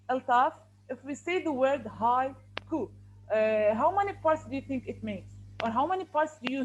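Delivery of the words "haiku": "haiku" slowly pulled apart, hai and ku; syllable by syllable. Shown as a demonstration. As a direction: let the class hear two parts in "haiku".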